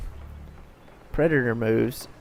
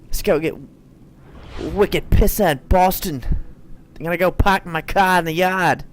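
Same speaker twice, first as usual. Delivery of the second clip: hammed-up Boston accent, louder; terrible Boston accent; 3 semitones higher, 5 dB louder than usual. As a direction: do a bad Boston accent, "pahk the cah". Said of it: "A terrible Boston accent".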